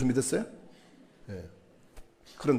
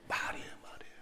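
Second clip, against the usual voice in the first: whisper; 19 dB quieter than usual. Real, not acted. Hushed repetition after loud question.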